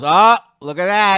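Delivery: loud, high, drawn-out exclamation; imitated wife. Imitating his wife watching bobsled, loud impressed exclamation. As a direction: mimic a wife exclaiming at the screen. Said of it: "my wife Susan knows. She goes".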